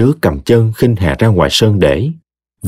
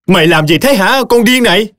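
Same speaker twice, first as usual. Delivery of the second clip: angry shriek; 12 semitones higher than usual. Acted. Victim shouting back at attacker.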